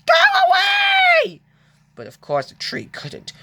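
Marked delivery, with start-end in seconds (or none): 0.1-1.4 very high, loud panicked shout; high, loud frightened cry; cry for help. Acted. James panics at tree.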